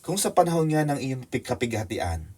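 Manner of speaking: usual speaking voice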